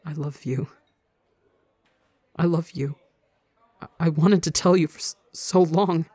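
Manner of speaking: tearful, breaking in the throat; warbling brokenly. Acted. Ranbu confessing love to Tubbo, voice breaking. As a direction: let the voice crack as you confess. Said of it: "Warbles brokenly in the back of his throat".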